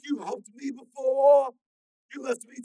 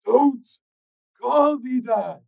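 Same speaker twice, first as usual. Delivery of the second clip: high, slow loud growl; strained warning; 18 dB louder, much slower than usual. A narrator's reading. Stalker warning Steel, slow and strained. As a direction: hold him back, slowly.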